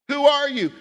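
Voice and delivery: high, loud "Who are you?" with "are" punched; pressing question. Shown as a demonstration. Preacher repeatedly pressing the congregation: who are you. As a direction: demand it with "are" stressed. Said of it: "If I were to say".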